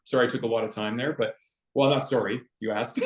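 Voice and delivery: normal talking voice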